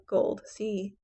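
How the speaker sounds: ordinary talk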